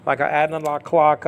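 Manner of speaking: ordinary talk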